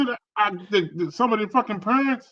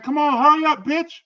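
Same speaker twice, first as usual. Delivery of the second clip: put-on kid's yell; 10 semitones higher, 3 dB louder than usual. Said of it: "They was like".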